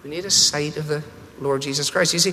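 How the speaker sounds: usual speaking voice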